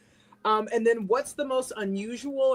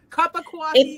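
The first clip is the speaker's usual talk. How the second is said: New York accent; 8 semitones higher, 9 dB louder than usual. Shown as a demonstration. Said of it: "Have to say it in the New York accent".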